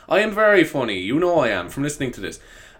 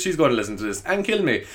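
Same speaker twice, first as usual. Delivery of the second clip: ordinary talk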